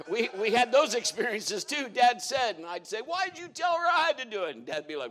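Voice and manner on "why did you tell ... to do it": put-on high, aggrieved child's complaint; whiny kid. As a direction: protest like a wronged little brother. Retold childhood complaint to dad.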